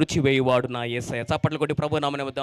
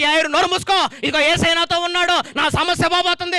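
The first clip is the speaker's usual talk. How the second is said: louder, higher retort; defiant father; 10 semitones higher, 8 dB louder than usual. Acted. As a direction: silence the crowd. Jairus shouts back. (బిగ్గరగా ధైర్యంగా తండ్రి జవాబు)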